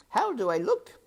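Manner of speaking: put-on simple girl chatting; serving girl. A narrator's reading.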